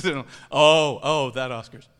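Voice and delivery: loud laughing oh; laughing realization. Real, not acted. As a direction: laugh as it clicks. Realizing which Oscars.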